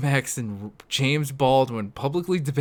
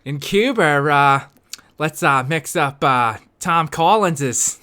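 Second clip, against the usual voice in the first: Boston JFK; slower than usual. Pretend. JFK impression continues.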